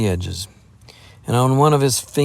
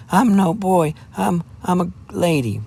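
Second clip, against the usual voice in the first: much higher, angry and stammering; outraged dignity; 9 semitones higher than usual. Acted. Jeannie revealing herself to the lad, outraged trembling voice. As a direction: draw yourself up, wounded and furious. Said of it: "angry, trembling voice of outraged dignity".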